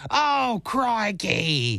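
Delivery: put-on a kid's playful Australian exclamation; Australian accent. Dad retells his son's pirate-ship cry, imitated Australian. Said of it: "That's Australian".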